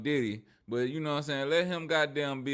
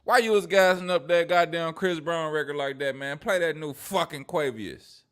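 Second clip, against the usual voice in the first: louder, higher mock call-out; 6 semitones higher, 8 dB louder than usual. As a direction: act out an insistent complaint, voice raised. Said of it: "[name] ain't hit him up and said".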